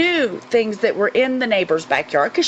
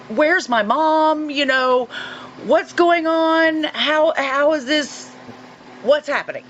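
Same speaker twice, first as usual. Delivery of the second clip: higher, slow, frightened questions; retold childhood panic; 5 semitones higher, slower than usual. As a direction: replay a scared child's panic, pitched up and drawn out. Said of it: "I'm thinking".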